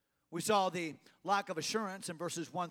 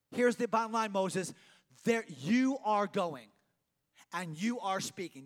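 louder, slow and emphatic; forceful, deliberate command; firm ultimatum; 5 dB louder, slower than usual. Acted. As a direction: lay it down word by word. God overruling Moses, preacher's voice raised.

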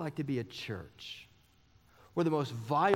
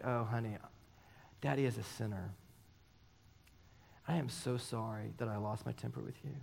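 tender apology; 4 semitones lower, 7 dB quieter than usual. Retold or imitated. Father apologizing to daughter.

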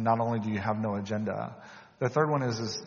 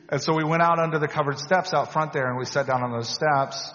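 ordinary talk

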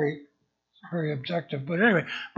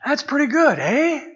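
a light mock Canadian accent, higher and louder; 8 semitones higher, 5 dB louder than usual. Pastor jokes to the congregation in a Canadian accent. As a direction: tack a Canadian "eh" on, hammed up. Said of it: "you have to get the Canadians in there".

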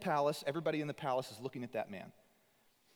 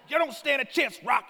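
much higher, louder gritty Boston bark; gritty Boston trainer; 11 semitones higher, 9 dB louder than usual. Retold or imitated. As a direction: snap it like an old Boston boxing coach. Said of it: "kinda gritty, like, Boston guy".

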